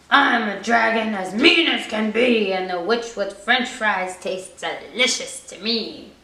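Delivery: menacing dragon voice, low and loud; scary dragon. Acted. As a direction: growl it like a hungry dragon. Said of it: "a roar that was scary and loud".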